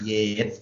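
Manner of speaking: Yeats with the "ay" held long; long vowel. Shown as a demonstration. Confirming the name for listeners, long vowel. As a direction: stretch the middle of Yeats. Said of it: "เสียงยาวนะคะ".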